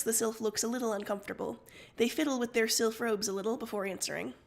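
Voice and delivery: usual speaking voice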